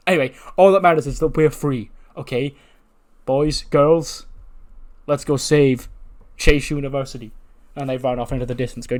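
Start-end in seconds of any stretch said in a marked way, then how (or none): 0.1-7.3 loud, higher call to action; rallying cry. Acted. Teacher rallying boys and girls to save the university, rallying cry. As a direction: round up the troops loudly. Teacher rallies freed group.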